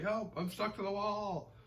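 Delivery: high, drawn-out comic wail; mock cry for help. Tony stuck to the wall, high mock wail for help.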